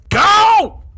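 loud high shout to kick off the segment; hype shout. Real, not acted. Host hyping the Florida Man segment, loud high shout.

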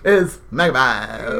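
low, slow Megamind voice; deep cartoon villain voice. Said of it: "screw old-timey radio voice, you have to talk like that".

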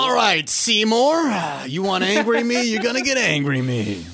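high, loud, riled-up challenge; hammed-up challenge, starting high and loud; mock confrontational. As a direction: work yourself up like a joking tough guy. Acting out challenge to rude clerk.

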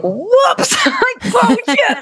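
high, comic 'oh no' squeak; mock oops. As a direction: play the sudden oops moment big and high. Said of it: "I was like".

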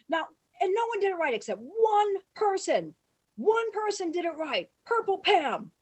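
high, loud, emphatic proclamation; yelled announcement. Retold or imitated. Director singling out the one good extra, yelling. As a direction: holler it, leaning on each key word. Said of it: "Lloyd starts screaming".

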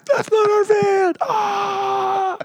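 very high drawn-out yell ending in a scream; hammed-up wailing realization; mock panicked cry. Drunk friend slamming the stranger's van shut, mock panic.

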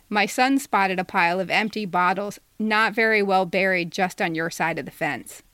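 usual speaking voice

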